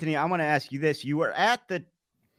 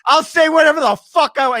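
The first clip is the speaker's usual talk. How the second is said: higher and louder, furious snap; angry; 11 semitones higher, 7 dB louder than usual. Retold or imitated. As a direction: bark it out in mock fury. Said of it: "Both of us just immediately anger".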